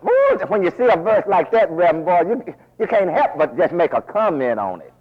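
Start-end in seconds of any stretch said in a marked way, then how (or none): 0.0-0.4 high excited exclamation; excited ooh. Real, not acted. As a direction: let it rise up in delight. Reacting to the verse.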